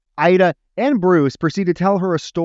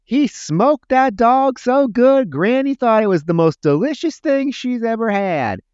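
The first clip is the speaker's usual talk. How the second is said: gleeful Ida impression; 10 semitones higher, 5 dB louder than usual. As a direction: gloat over the dog like a delighted hillbilly wife. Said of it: "Ida chimes in".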